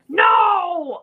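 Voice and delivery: loud, high drawn-out cry; acted protest. Child to mom's extreme offer, horrified protest. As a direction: object in mock horror.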